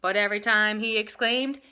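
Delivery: plain reading voice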